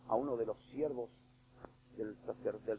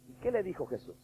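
usual speaking voice

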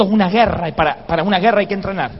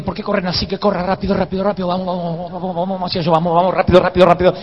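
hurried urging; faster than usual. Retold or imitated. Retells urging slow teammates to run.

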